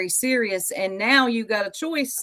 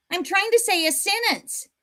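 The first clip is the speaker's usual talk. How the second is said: high squeaky parody of his words; mocking whiny imitation; 10 semitones higher than usual. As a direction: mimic him like a whiny child. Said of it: "Do y'all hear this?".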